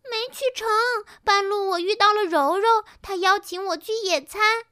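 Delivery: high-pitched, distraught cry; frantic wailing. A narrator's reading. New Pinky reports her missed outing, crying out frantically. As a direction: wail it out in a frenzy. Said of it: "新碧琪抓狂地哭喊道".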